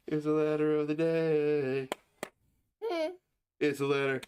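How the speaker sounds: high sing-song playful voice. Real, not acted. Father tickling his baby, high sing-song play.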